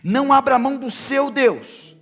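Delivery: loud, high shouted exhortation; full-force preaching. Acted. Preacher voices Moses warning the people against other gods, full-force preaching. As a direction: thunder the command, building to a shouted finish.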